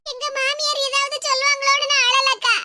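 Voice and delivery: tearful, whimpering reply; weepy, choked-up protest; crying. Acted. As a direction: answer through tears. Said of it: "இப்படி அழுதுகிட்டு இருக்க".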